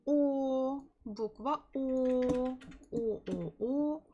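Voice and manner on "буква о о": usual speaking voice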